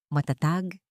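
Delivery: usual speaking voice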